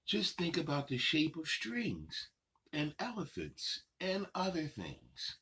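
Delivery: sing-song picture book reading, unhurried pauses; reading to children. Acted. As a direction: read the rhymes to little kids, savoring each line. Reads picture book aloud.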